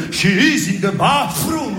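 mock-careful English accent with the tongue; exaggerated English accent. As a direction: overdo the English pronunciation proudly. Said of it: "Attention à l'accent, avec la langue".

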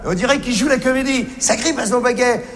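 ordinary delivery

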